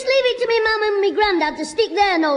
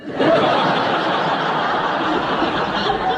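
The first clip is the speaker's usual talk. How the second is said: giggling laugh; 2 semitones higher than usual. Real, not acted. Boy laughs at the vicar joke.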